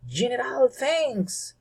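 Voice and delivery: high joyful exclamation; exclaiming. Acted. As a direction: cry out in delighted thanks, pitched high. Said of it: "exclaimed Eriki".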